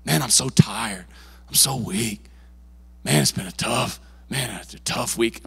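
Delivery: low, slow, drained whining; mock weary complaining. Pastor mocking a churchgoer's weary complaint, low and slow. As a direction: sigh it out like you're worn out. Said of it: "don't talk like that".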